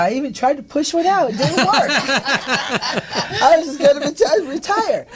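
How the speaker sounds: retelling Phil Donahue's line with laughter; laughing impersonation. Retold or imitated. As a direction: tell the joke while cracking up. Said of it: "He said".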